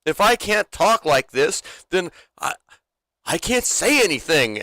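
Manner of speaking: high, insistent mock protest; Ricky. TV character defending his cussing to the court, insistent. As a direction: argue it out, pitched well up. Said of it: "He's like".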